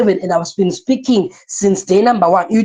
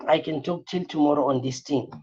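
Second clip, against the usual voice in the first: much softer and lower, trailing off to himself; muttered aside; 6 semitones lower, 11 dB quieter than usual. Real, not acted. Teacher mumbling to himself, quiet aside while looking at notes. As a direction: drop the voice and mumble it half to yourself.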